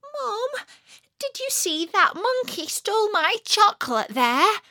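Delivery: put-on high, bewildered child voice; a small boy calling to his mum in disbelief; startled little boy. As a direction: kid too shocked to cry, piping up. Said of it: "He just said".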